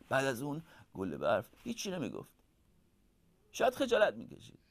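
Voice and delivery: plain reading voice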